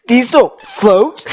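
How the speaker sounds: slow, whiny imitation of partner; mimicking a partner's tearful logic; mock pouty quote. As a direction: drag it out like a sulky child. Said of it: "you're like".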